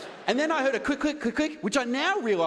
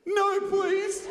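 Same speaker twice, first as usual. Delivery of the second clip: higher, timid little plea; meek refusal; 7 semitones higher than usual. Retold or imitated. Comic recalling his feeble refusal to the masseuse, high and timid. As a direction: squeak out a feeble no. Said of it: "this was the best that I could come out with".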